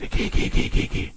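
flüsternd, zaghaft; ängstliches Flüstern. Retold or imitated. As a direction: scheuch den Hund ganz leise weg. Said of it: "Und sagt". (zaghaft geflüstertes Geh)

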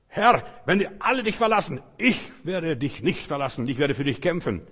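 loud fervent pledge of loyalty; boastful vow. Acted. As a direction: swear loyalty with a puffed-up chest. Peter's boastful vow voiced.

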